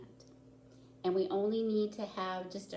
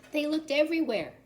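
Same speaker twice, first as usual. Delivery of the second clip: higher, louder and faster, a sudden storyteller's exclaim; dramatic burst; 10 semitones higher, 8 dB louder, faster than usual. A narrator's reading. Teacher reading to children, bursting out excitedly. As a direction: throw the line out big to grab the children.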